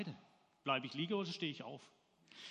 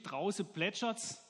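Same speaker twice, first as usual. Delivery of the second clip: ordinary delivery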